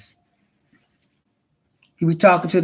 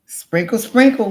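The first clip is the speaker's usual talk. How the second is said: catchphrase; 5 semitones higher, 6 dB louder than usual. Real, not acted. Catchphrase sales plug.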